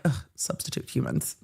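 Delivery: softly whispered cat disdain; a put-upon cat, breathy and soft; mock disdain. Cats sniffing at stand-in humans, whispered disdain. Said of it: "the cats are like".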